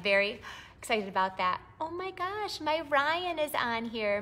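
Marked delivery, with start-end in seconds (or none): 1.8-4.2 delighted surprise. Real, not acted. Sees her son in live chat.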